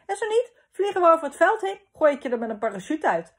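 quick, high, loud jest; joking laughingly. A narrator's reading. Papa teasing Gijs about being late, jokey. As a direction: toss off the joke fast and high. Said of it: "lachte hij".

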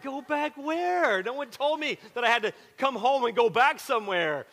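confused and caught off guard; bewildered protest. Retold or imitated. New kid confused by the rules yelling back, bewildered.

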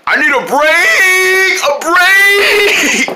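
high, loud, slow shout; stretched angry cry; angry yell. Acted. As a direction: demand a break furiously. Mad character yells.

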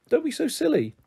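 very high, coaxing reproach; chiding. Acted. Mum brushing off her daughter's refusal, chiding. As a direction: lift the pitch right up, gently scolding.

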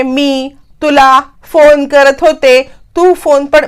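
slow, spaced-out dictation; one word at a time, slowly. Retold or imitated. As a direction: spell out each word as if typing it. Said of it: "एक एक शब्द".

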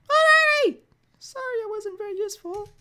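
high-pitched cartoon old lady; Mickey Mouse voice. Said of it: "why do I sound like Mickey Mouse".

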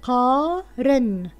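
قارن stretched out very slowly; slow drawn-out. Shown as a demonstration. Teacher drawing out قارن for pupils, very slowly. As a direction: draw out قارن for the children. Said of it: "الكلمة هي من".

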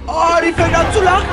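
loud, high startled cry; shocked outcry. Real, not acted. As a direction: react in alarm to the laugh. Shocked by laughing ghost. (erschrocken laut, hoch)

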